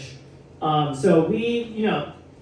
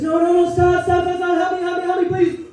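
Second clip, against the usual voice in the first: much higher, loud mock pleading; Brian begging; 14 semitones higher, 5 dB louder than usual. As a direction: beg for help, hammed up.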